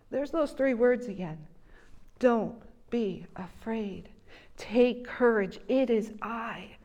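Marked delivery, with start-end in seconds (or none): 2.2-6.8 slow, soft, half-whispered comfort; hushed reassurance. Retold or imitated. Preacher repeating Jesus to the disciples, hushed and gentle. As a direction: lean in and soothe.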